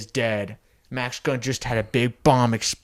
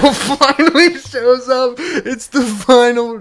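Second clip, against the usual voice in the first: high, loud laughing voice; 11 semitones higher, 9 dB louder than usual. Acted. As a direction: laugh through the big reveal. Grinch's big reveal, laughing.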